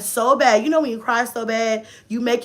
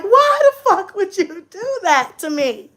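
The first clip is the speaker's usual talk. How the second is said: high, slow anguished wail; hammed-up exasperated pleading; mad; 8 semitones higher than usual. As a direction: cry out as the wronged parent. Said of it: "I was so fucking mad".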